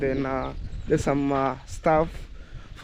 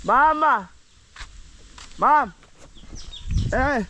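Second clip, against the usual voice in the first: calling out; 10 semitones higher, slower than usual. Real, not acted. Calling out to mother.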